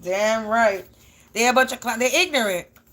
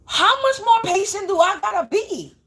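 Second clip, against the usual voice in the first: higher mock exasperation at being told to wait; lightly played fed-up self, pitch raised; half-laughing at God, how much more; fed-up disbelief; 6 semitones higher than usual. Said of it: "I be like".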